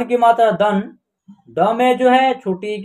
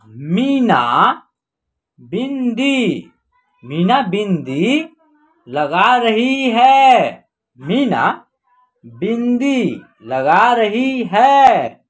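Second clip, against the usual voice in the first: slow word-by-word sentence reading; teacher reading slowly word by word; slow reading aloud; much slower than usual. Shown as a demonstration. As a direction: read it out slowly for learners. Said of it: "हम direct पढ़ना सिखाएंगे आपको".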